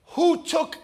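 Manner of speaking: high-pitched distressed demand; mock alarm. Woman finding her Savior gone, mildly alarmed. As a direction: act out a startled question up high. Mary finds the tomb empty.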